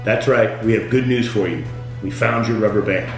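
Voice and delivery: normal talking voice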